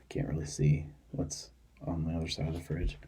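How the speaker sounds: soft whispered aside; whispering. Real, not acted. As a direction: whisper it to yourself. Checking the fridge aside.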